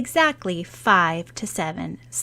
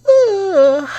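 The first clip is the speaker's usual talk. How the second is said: a high sleepy yawn on ugh; yawning groan; 13 semitones higher, 9 dB louder than usual. Acted. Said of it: "yawning also".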